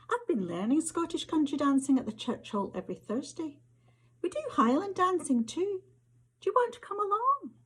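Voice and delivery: put-on high, quick boyish Scots voice; eager boy. Rory cornering her after geography, high and quick. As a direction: rattle it off like a keen schoolboy.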